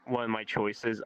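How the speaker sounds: ordinary talk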